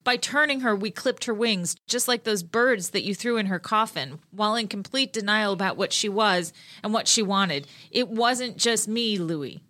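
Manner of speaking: ordinary delivery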